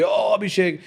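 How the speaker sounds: excited crowd cheer. Retold or imitated. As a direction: call it out like an adoring crowd. Voices the crowd greeting him.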